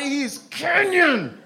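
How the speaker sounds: "Kenyan" stretched very slow and high; drawn-out high shout. Acted.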